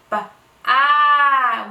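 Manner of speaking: ba, then a long loud ah, vowel drawn out; stretched vowel. Shown as a demonstration. Teacher modelling a stretched vowel for her child, drawn out. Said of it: "emphasize those vowels".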